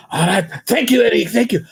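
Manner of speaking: put-on grateful French-accented gushing; imitating a relieved Frenchman. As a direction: thank them in a comic French accent. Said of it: "I worked with a Frenchman".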